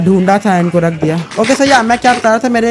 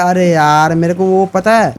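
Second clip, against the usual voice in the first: ordinary talk, nothing marked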